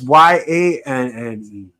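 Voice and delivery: slow, deliberate letter-by-letter spelling. Real, not acted. Podcaster corrects his co-host's spelling of Jordan Grace, slow letter by letter. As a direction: spell each letter slowly and pointedly.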